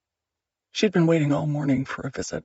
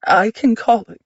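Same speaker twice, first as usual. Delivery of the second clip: higher and louder, panicked rush; 8 semitones higher, 6 dB louder than usual. Acted. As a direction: blurt it out in panic. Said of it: "Cassie's panicked voice".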